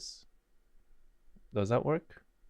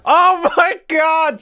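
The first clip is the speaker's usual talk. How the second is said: excited shriek; 12 semitones higher, 5 dB louder, much slower than usual. Real, not acted. Reacting on replay.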